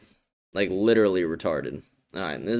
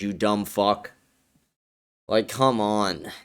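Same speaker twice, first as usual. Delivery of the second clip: normal talking voice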